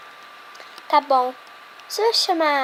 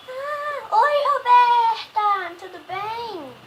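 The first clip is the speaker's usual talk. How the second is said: high doll voice; 9 semitones higher, 3 dB louder, much slower than usual. Pretend. New doll voice greets friend.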